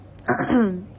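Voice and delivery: a rough clearing of a sore throat. Real, not acted. Vlogger with a sore throat clearing it mid-talk. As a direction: clear the hoarse throat before going on. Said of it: "आज मेरा गला थोड़ा खराब हो गया है".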